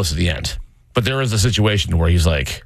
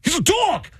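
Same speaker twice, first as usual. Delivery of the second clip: put-on very high squeaky kid voice. Kids' squeaky high voice answering grandma. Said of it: "they're like".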